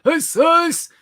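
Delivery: squeaky cartoon shout; high squeaky voice. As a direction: squeal it like a toy.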